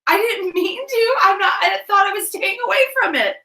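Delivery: high, loud, squealed self-defence; flustered protest. Real, not acted. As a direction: plead innocence in amused horror.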